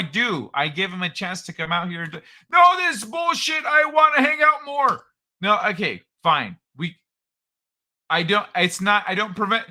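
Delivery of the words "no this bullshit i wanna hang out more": put-on high, loud old-man character voice; Old Man Kai voice. Host ribbing his co-host, as a high, loud old man. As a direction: play the cranky old man, pitched up.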